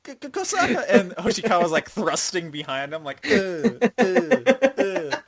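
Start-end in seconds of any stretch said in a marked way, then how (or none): 0.0-0.8 very high stammering cry for Kosaka; hammed-up high-pitched panic; panicked girl. Haruka voiced panicking. 3.3-5.2 mock grunts. Hoshikawa grunting thrusting.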